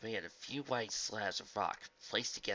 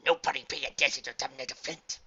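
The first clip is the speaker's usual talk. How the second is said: creature voice; 4 semitones higher, 7 dB louder than usual. Acted. Creature voice continues.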